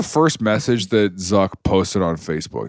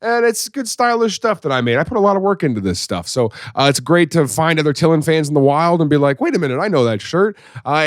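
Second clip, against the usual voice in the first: bright fast podcast outro; ad read; 4 semitones higher than usual. Acted. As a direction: read it like a cheerful promo.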